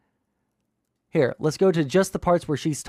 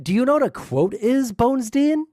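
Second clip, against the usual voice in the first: higher, incredulous yell at the viewer; yelling at chat; 7 semitones higher than usual. Real, not acted. Streamer yells at a viewer about quotes, exasperated. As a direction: demand it, exasperated and pitched up. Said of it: "yelling at chat the entire time".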